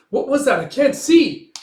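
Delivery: high, loud confused shout; acted panic, calling out; alarmed cry. Men blinded after the explosion, alarmed cry.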